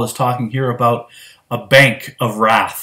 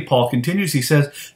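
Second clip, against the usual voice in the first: ordinary talk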